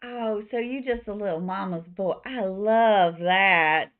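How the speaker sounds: teasing Southern gush, slow loud finish; flirty Southern barkeep; Louisa. Acted. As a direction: tease with a Southern accent, stretching the last words. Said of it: "I don't know why she's slightly Southern".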